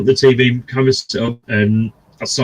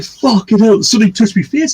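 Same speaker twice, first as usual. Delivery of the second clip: retold fright; 8 semitones higher than usual. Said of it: "I was like".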